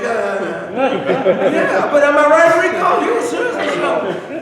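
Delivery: loud and high, laughing at a friend; laughing, loud teasing of Rico; laughing tease. Real, not acted.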